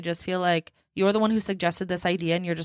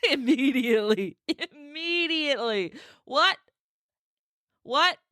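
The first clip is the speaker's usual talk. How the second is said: laughing fit; 5 semitones higher than usual. Real, not acted. Laughing at own bluntness.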